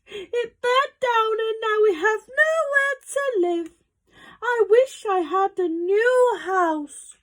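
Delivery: very high mother-mouse voice; squeaking mouse. A narrator's reading. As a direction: squeak it out in distress. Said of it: "squeaked the mother mouse".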